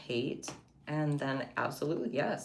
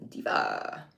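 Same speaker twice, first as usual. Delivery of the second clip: very low, falling "Diva"; deep diva voice. Pretend. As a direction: drop "Diva" way down low and slow. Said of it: "put Diva on this one".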